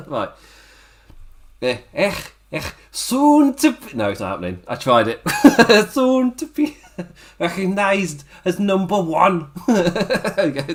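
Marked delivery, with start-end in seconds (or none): 1.6-3.9 high, slow failed Scouse voice; a strained go at Liverpool Scouse; Scouse accent. Pretend. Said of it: "do a Scouse accent". 5.9-10.7 slow, higher strained Scouse with laughter breaking in; laughing through a failed Liverpool Scouse impression; Scouse accent. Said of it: "my attempted failed impression".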